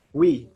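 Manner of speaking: "we" loud and clear as WEE; UI sounded as WEE. Shown as a demonstration. Teacher demonstrates U I to students, loud WEE sound. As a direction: punch out "we" for the French U-I. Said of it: "we normally pronounce it like".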